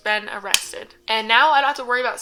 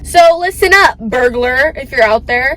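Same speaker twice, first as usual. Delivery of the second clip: loud callout; 5 semitones higher, 9 dB louder than usual. Real, not acted. Calling out the burglar on camera.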